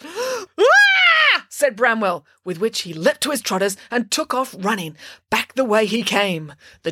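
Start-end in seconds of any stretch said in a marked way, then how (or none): 0.1-1.5 loud, high scream; frightened scream. A narrator's reading. Bramwell threatened by the giant, high terrified scream. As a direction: scream in sudden terror.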